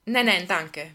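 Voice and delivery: loud, brisk refusal; emphatic declining of help; fending off. A narrator's reading. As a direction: wave the offer away. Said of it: "wehrte Lisa ab".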